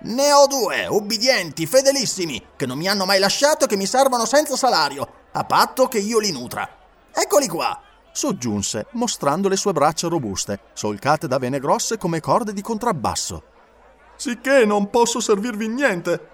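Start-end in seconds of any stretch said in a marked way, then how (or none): 0.0-7.8 higher and louder, swaggering; boastful showman. A narrator's reading. Batulcar voiced. 14.1-16.3 higher, dismissive; showman's refusal. A narrator's reading. Batulcar's refusal.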